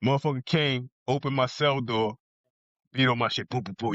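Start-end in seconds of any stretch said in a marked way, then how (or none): none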